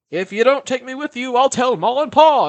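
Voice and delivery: high, loud tattletale whine; high loud bratty voice; bratty threat. Retold or imitated. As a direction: threaten like a pushy kid sister. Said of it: "And she's like".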